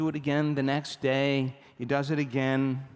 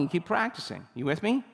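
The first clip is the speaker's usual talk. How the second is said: ordinary talk, nothing marked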